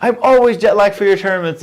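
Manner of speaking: high, loud, mock-whiny griping; whiny complaint. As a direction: act out a jet-lagged player's complaint. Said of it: "I had a joke where I'm like".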